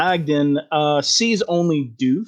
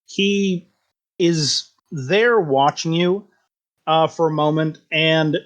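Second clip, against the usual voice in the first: ordinary delivery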